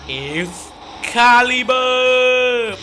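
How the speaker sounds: held-back first syllable, then a high, loud burst; attack-name shout. Acted. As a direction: build into a belted battle cry. Repeats the ultimate attack cry. (ตะโกนชื่อท่าไม้ตายไล่ขึ้นจนสุดเสียง)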